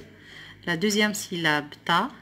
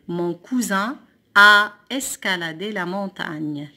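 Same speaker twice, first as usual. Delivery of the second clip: slow, louder, joined-up sentence reading; whole sentence; 5 dB louder than usual. Shown as a demonstration. Teacher reading the rebuilt sentence whole, slowly. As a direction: read the sentence through slowly and firmly. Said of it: "découpe les phrases puis recopie-les".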